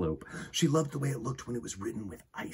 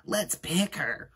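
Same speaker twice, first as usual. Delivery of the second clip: put-on teasing child; 8 semitones higher, slower than usual. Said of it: "said Rita".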